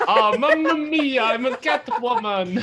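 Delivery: high, slow, loud mock Italian chef; jolly italian pizza chef. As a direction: ham up a cartoon Italian accent. Echoing the Italian bit.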